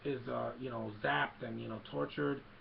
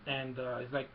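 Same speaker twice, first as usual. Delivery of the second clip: ordinary talk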